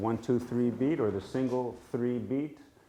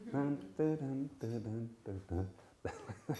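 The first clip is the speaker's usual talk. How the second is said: polka rhythm sung; 8 dB quieter than usual. Shown as a demonstration. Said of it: "Sort of like a polka".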